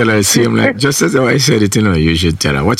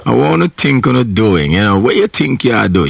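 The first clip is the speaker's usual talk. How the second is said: Jamaican patois; 5 semitones higher than usual. Shown as a demonstration. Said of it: "She have to talk like that".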